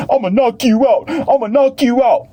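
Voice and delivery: high mock taunting yell; mock boxer yell. Boxer in the ring taunting his opponent, high mock yell. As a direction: ham up a fighter's trash talk. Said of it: "they screaming in your face".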